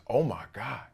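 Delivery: soft, mock-awed realization; quiet mimicked disbelief; hushed realization. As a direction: say it low, as others dawning on it. Voices people's later realization.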